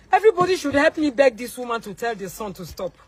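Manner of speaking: ordinary talk, nothing marked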